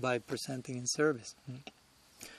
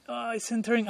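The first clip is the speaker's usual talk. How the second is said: sudden high-pitched cry of a fan watching the ball; excited fan; 8 semitones higher than usual. Retold or imitated. Preacher acting out a soccer fan as the ball nears the goal, excited high cry. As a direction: squeal like the goal is seconds away.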